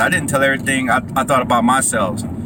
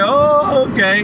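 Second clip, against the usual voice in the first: high mock naive reply; 11 semitones higher, slower than usual. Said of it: "I was like".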